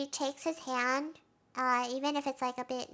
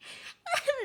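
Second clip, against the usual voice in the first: high giggle; 13 semitones higher than usual. Real, not acted. Laughs at the scene.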